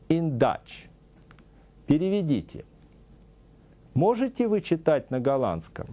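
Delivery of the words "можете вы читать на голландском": ordinary delivery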